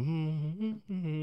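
soft, idle "hmm" hums; indifferent humming. Acted. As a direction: hum quietly while letting a call ring out. Idly watching the phone ring.

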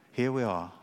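normal talking voice

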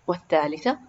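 ordinary delivery, nothing marked

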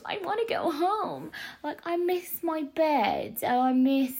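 high, whiny plea to go home; homesick whine. Real, not acted. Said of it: "I just miss my home".

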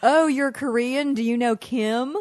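slow, drawn-out cluelessly friendly stranger. Acted. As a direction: play a stranger asking something stupid, very slowly. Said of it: "in every accent, in every dialect".

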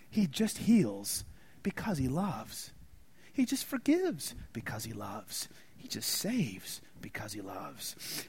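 from spoken down to a hushed whisper; fading to a whisper. Acted. Sermon builds to a hush.

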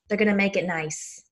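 lightly attempted New York accent; a hint of New York housewife accent; Dorinda Medley. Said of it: "my attempt at a New York accent".

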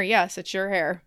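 usual speaking voice

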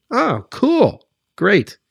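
high mock-cheerful "Oh, cool"; sarcastic delight. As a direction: oversell it as fake joy. Mocking Kardashian recommendations.

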